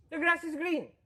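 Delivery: very high, drawn voice; high-pitched swami. Retold or imitated. Swami repeating to puzzled novices in high voice. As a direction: squeak it out like the old swami. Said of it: "he had a high-pitched voice".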